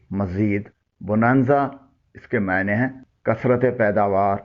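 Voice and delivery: normal talking voice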